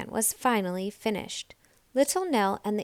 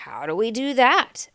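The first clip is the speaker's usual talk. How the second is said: louder, odd hare voice; 2 semitones higher, 6 dB louder than usual. A narrator's reading. Hare asks little Nell what to do. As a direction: ask puzzled. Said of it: "asked Hare".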